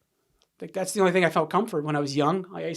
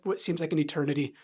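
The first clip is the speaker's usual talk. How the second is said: normal talking voice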